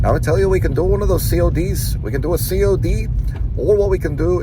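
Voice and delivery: a hammed-up Irish door-to-door pitch; Irish accent. Doc salesman pitching a customer, in imitated Irish accent. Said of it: "he would go into an Irish accent".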